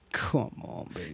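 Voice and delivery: breathy whispered come-on; whispered coaxing. Retold or imitated. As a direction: whisper it seductively. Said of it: "He's like".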